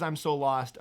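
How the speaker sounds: ordinary talk, nothing marked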